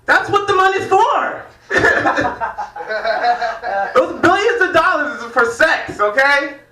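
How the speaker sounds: high, loud laughing retort; mock indignant retort. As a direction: fire back like it's obvious, cracking up. Mock-answering a Facebook comment.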